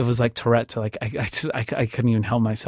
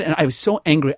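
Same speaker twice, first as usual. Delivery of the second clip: ordinary talk, nothing marked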